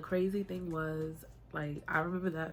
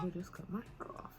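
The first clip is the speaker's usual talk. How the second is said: a shocked whisper pitched higher; stunned whisper; 8 semitones higher, 8 dB quieter, faster than usual. Retold or imitated. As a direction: breathe it out in disbelief. Stunned moment after chair spins.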